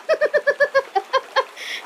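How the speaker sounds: a long, high, squeaky burst of laughter; helpless high laugh at her own joke; high squealing laugh. Real, not acted. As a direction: let out a shrill-pitched giggle fit. Laughs after being called human.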